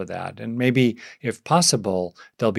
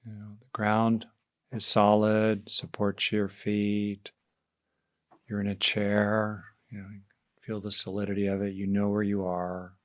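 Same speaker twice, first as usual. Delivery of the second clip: guided meditation. Real, not acted. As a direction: ease them gently into settling, leaving space between phrases.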